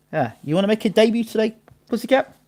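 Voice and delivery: high-pitched pet talk; coaxing a cat. Real, not acted. Streamer inviting his cat on air, high coaxing. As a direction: lift the voice high to coax a pet.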